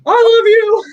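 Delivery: very high, loud squeal of delight; squealed thanks. Retold or imitated. Artist overjoyed as his first collector buys two paintings, squealing. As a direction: gush it out sky-high like a thrilled fan. Retelling joy at a big sale.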